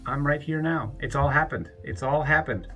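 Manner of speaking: ordinary talk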